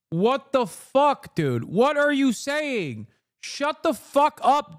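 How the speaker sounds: high, drawn-out exasperated outburst; outraged disbelief. Real, not acted. Streamer reacting to a politician's clip, outraged disbelief. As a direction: stretch each phrase in indignant disbelief.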